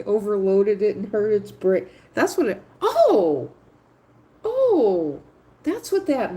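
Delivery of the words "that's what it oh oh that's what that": surprised realization. Real, not acted. Sudden realization exclamation.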